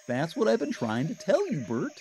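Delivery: high, bright puppet voice for Ernie. A narrator's reading. Ernie teasing Bert, high cheerful puppet voice. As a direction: play the giddy Ernie.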